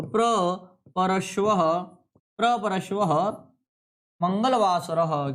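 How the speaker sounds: प्रपरश्वः drawn out very slowly; slow dictation. Shown as a demonstration. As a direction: stretch प्रपरश्वः syllable by syllable. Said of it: "आपको बताना है".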